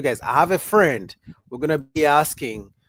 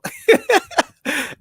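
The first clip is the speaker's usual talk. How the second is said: loud high laugh; 6 dB louder than usual. Real, not acted. Reacting to a viewer comment, loud high laugh.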